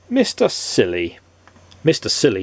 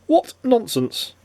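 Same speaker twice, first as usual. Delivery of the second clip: 1 mock scorn; 12 semitones higher, slower than usual. A narrator's reading. Narrator exclaims, echoing character.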